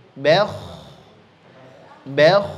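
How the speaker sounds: "Bel" with thin b and heavy flowing kh; correct bel. Shown as a demonstration. Teacher modelling the thin-then-heavy syllable. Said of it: "sambil ngalir".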